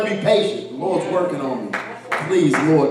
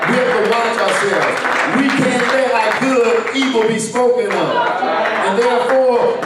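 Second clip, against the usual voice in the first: raised, loud preaching to the church; 4 semitones higher, 4 dB louder than usual. Acted. Pastor exhorting the congregation, loud preaching voice. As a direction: preach it out with rising fervor.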